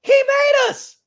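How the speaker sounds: loud, high, slow proclamation; preaching shout. Real, not acted. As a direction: proclaim it from the pulpit. Preaching climax.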